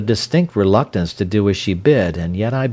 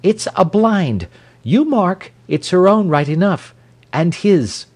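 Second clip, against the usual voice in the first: high-pitched, insistent accusation; voiced as an excitable, suspicious wife; crying out; 11 semitones higher, 3 dB louder than usual. A narrator's reading. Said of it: "she cried".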